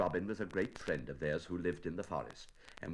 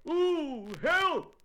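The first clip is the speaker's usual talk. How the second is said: very high, loud cry for help; distressed bear; 8 dB louder than usual. Acted. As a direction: wail it as the stuck bear. Pooh gets stuck, calls out.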